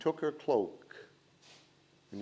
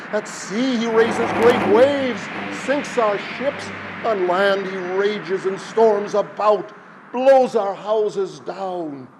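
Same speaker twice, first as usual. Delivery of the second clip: high, loud frantic crowd cry; people crying out; 6 semitones higher, 9 dB louder than usual. A narrator's reading. Villagers warn Silver Hands of the wind, loud frantic cry. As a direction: cry out in desperate warning. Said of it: "the people cried in one voice".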